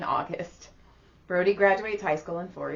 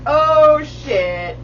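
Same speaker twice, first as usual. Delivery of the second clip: high, loud, drawn-out "oh"; retold dawning shock; 7 semitones higher, 11 dB louder than usual. Hearing the move lands when the lease ends, reenacted shock. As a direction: draw out the first word loudly as it hits you. Reacting to alarming lease timing.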